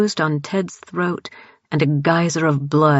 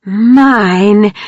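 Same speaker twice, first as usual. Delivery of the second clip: loud inhuman higher roar; monstrous roar; 5 semitones higher, 8 dB louder than usual. A narrator's reading. Grace claiming Dawn as prey, inhuman roar. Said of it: "Grace roared".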